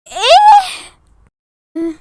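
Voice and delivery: very high, loud drawn-out ايه؟; shocked shriek. Acted. Shocked at love confession. (صرخة دهشة عالية)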